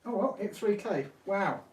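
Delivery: happily taken aback, voice lifted; pleased amazement. Real, not acted. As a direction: marvel at a milestone just reached. Reacts to hitting milestone.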